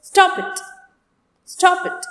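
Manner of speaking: high-pitched "Stop it" given as a short order; demonstrated command, high on each "Stop it". Shown as a demonstration. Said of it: "giving an order or making a command".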